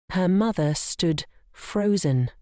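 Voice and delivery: ordinary delivery, nothing marked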